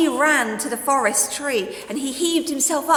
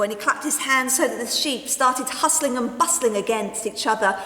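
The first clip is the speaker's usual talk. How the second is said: ordinary delivery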